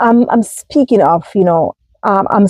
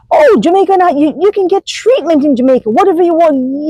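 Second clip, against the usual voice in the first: mocking critics; 8 semitones higher than usual. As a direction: toss it off like a know-it-all from back home. Imitating critics from back home.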